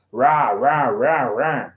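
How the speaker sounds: rah, rah with an exaggerated rolling-along R; mock American R on each rah; American R sound. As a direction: imitate American talk as repeated rah. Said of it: "American people talk, they have more like".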